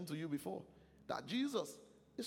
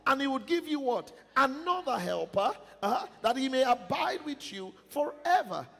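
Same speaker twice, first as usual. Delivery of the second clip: reading scripture aloud at full force, higher; loud scripture reading; 6 semitones higher, 7 dB louder than usual. Acted. Preacher leads the church reading scripture, loud and ringing. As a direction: proclaim the verses with the congregation.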